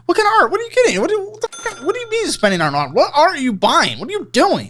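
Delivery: high-pitched, incredulous rapid-fire questions; baffled, exasperated grilling. Real, not acted. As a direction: demand answers in high-pitched disbelief. Host baffled by guest's art spending.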